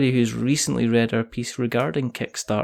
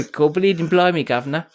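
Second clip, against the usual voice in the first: hammed-up mock cockney accent, a bit higher; 7 semitones higher, 3 dB louder than usual. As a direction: ham up a fake cockney accent like a bad film chimney sweep.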